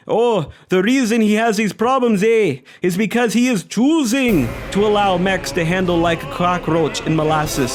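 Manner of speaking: bad Canadian accent impression. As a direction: ham up a clumsy Canadian accent. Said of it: "that was a terrible Canadian accent".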